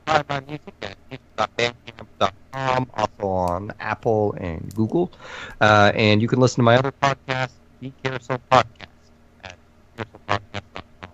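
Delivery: glitchy robotic mic voice; distorted robot-sounding audio. Real, not acted. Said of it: "gave us super robot voice".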